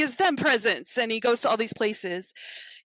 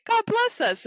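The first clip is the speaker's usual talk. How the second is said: put-on much higher, tiny child voice; small frog voice; 3 dB louder than usual. Little Robin starting the blessing, tiny high voice. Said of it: "Robin starts to say".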